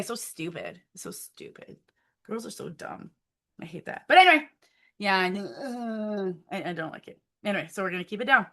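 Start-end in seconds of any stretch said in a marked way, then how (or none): 0.0-4.0 muttered. Real, not acted. Embarrassed about her hair.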